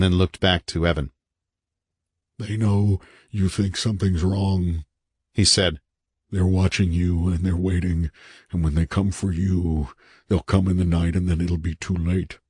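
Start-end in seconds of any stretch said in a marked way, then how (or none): 2.4-4.8 haunted man, ominous. A narrator's reading. 6.3-12.4 haunted man, ominous. A narrator's reading.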